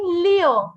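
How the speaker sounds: "Liu" said like "lyoh", mouth open; correct pronunciation. Shown as a demonstration. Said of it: "It's Liu".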